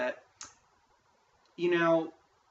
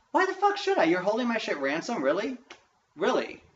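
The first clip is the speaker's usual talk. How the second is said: high, loud indignant outburst; outraged retort; 7 semitones higher, 4 dB louder than usual. Retold or imitated. Student to the clerk over fees, outraged retort. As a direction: fire it back in disbelief. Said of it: "I'm going".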